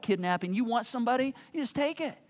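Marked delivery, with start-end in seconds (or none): none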